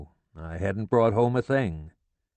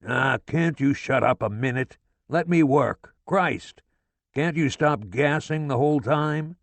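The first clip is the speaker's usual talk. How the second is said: exasperated father; 6 semitones higher than usual. A narrator's reading. Father snapping at wife.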